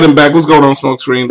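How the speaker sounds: normal talking voice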